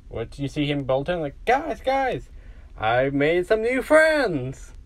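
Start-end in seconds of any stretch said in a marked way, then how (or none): 1.5-4.7 high, eager burst, then drawn out; childlike excitement, stretching the words out; excited announcement. Acted. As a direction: burst in with thrilling news, pitched high. Caboose bolts in with news.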